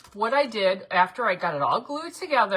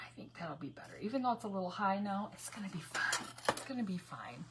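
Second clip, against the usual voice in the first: quiet aside; 11 dB quieter, faster than usual. Real, not acted. Muttering to herself.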